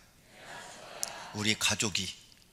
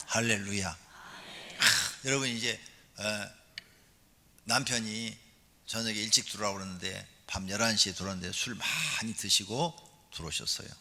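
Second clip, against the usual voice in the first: usual speaking voice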